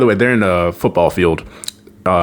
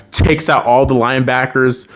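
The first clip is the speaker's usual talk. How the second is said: normal talking voice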